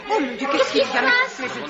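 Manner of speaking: high startled cry; shocked by the noise; alarmed. Acted. Sudden commotion. (exclamation aiguë et alarmée)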